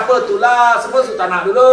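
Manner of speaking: cocky complaint. Retold or imitated. Last participant grumbles that everyone acts like sultans, high and cocky. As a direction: sound entitled and full of yourself. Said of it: "Lepas tu dia boleh cakap".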